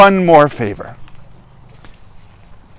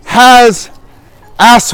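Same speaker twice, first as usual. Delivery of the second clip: ordinary delivery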